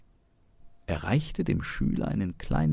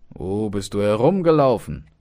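scolding father; 3 semitones higher, 9 dB louder than usual. Acted. Father scolds late-returning son.

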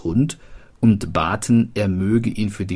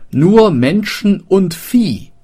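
high, loud, slow cry of horror; horrified mishearing; 11 semitones higher, 8 dB louder than usual. Acted. Townsman repeats what he misheard, in horror. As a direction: gasp it out in terror.

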